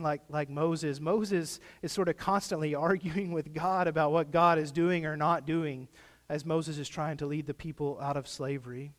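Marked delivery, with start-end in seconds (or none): none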